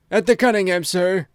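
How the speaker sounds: high, loud and gasping, the butler bursting in; breathless butler. A narrator's reading. Butler rushing in at breakfast, breathless and alarmed. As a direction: blurt it out, shaken out of all propriety. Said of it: "rushed in with all his propriety shaken out of him".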